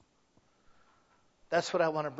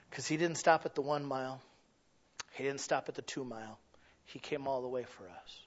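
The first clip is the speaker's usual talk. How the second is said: ordinary talk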